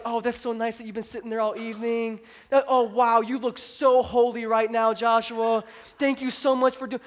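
gushing approval, put on; exaggerated admiring thanks; mock praise. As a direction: play at fawning over someone. Mocks a gentle response God avoided.

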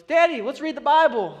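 put-on high, loud child voice; chirpy imitation of a small child; excited little daughter. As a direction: imitate an eager kid calling to a parent. Voices his young daughter.

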